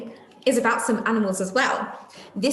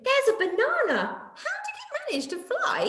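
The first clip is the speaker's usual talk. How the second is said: very high, fast squeak of wonder; astonished. Acted. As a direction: squeal in disbelief. Amazed at flying banana.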